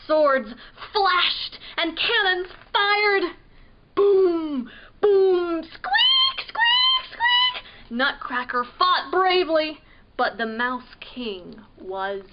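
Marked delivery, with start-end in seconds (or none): 3.9-5.6 loud booming blasts; cannon booms. A narrator's reading. Imitating the cannons in the battle. 5.6-7.6 very high, loud squeaks; mouse squeaks. Acted. Imitating the mice fighting the soldiers.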